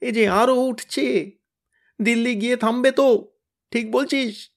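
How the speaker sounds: groaning. Acted. Tenida groans from high above, groaning. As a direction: moan it out helplessly. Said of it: "গোঙাতে গোঙাতে বললে".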